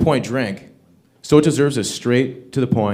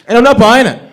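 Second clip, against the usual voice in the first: emphatic; 10 semitones higher, 9 dB louder than usual. Acted.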